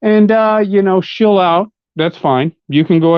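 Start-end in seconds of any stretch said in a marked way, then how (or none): 0.0-1.7 high, airy mock of a rival selling out; mocking sellout voice. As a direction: sneer it up high like a smug sellout. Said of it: "you're like".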